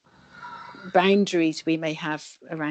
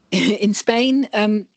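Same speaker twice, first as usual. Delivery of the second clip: laughing, louder and higher; 5 semitones higher, 8 dB louder, much slower than usual. Real, not acted. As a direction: answer through a laugh. Laughs as she answers.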